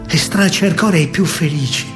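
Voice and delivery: ordinary talk, nothing marked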